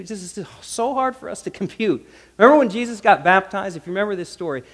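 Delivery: ordinary talk, nothing marked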